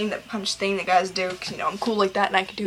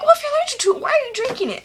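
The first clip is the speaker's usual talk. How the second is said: much higher, louder put-on voice of a baffled questioner; high hammed-up disbelieving voice; mock incredulous voice; 5 dB louder, faster than usual. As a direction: squeak it out like a puzzled friend. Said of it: "I'm like".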